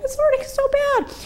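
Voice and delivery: high whimpering whine; whimpering complaint. Retold or imitated. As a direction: whine it out high like a hurt child. Pain at midnight.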